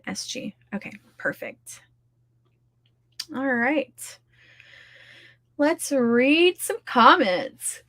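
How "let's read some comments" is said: slow words stretched through a real yawn. Real, not acted. Streamer reading chat comments, slow and yawning mid-sentence. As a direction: talk right through the yawn. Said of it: "Oh, I'm yawning".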